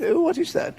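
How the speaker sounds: mimicked mother. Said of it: "And she said".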